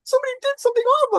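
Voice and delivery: very high, frantic mock squeal; hysterical wife. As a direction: sputter it in panicked falsetto. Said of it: "she's like".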